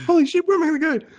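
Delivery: high, fast, panicked yelp; panicked kid. Retold or imitated. Boy in the shower yelping in panic, high and fast. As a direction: scramble for a way out, voice shooting up. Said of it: "He's like".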